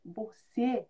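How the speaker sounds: low, soft imitation of silent mouthing; hushed mock lip-speak; mouthed, almost soundless. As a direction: mouth it with barely any voice. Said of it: "sem sair som".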